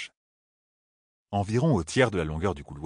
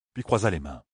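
ordinary delivery, nothing marked

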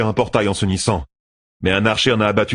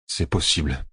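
much softer low mutter; 3 semitones lower, 5 dB quieter than usual. A narrator's reading. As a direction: mutter it grudgingly. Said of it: "marmonna Gibbon".

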